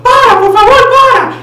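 very high, loud pleading shriek; shrieking girl. Pretend. Threatener voicing the sister screaming to stop. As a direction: scream like a terrified little sister. Ella va a estar gritando.

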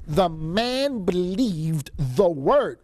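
"believed the word" drawn out very slowly; slow, deliberate stress on each word of "The man believed the word"; emphatic reading. Acted. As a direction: stretch every word, weighting "believed". Corrects imagined tantrum with the text.

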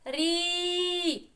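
"Рі" held long on a high note; sounding out syllable. Shown as a demonstration. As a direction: draw out the vowel of "Рі". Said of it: "перший склад".

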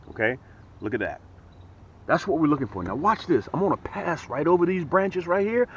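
ordinary talk, nothing marked

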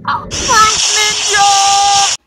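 drawn-out loud high whoop; excited yell. Real, not acted. Host celebrates a slicing trick with a slow loud whoop. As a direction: shout it like a trick just landed.

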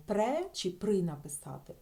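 normal talking voice

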